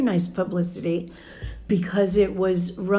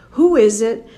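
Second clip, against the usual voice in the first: louder, calling out from the next room; calling from another room; 3 semitones higher, 7 dB louder than usual. Retold or imitated. Mother in another room, calling out loudly to the phone. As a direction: shout the question to someone in the other room. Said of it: "my mother in the other room is yelling".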